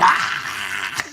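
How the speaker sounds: loud mock cheer-scream; imitated excited scream. Imitating son when the car started, excited scream. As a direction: burst out screaming like an overjoyed son. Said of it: "he used to get so excited".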